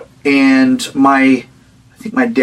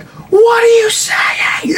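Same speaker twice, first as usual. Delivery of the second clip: very high, long-drawn shout; slow, high-pitched imitated scream; mock frantic shriek; much slower than usual. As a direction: stretch the question into a high nightmare shriek. Dream relatives at the climax.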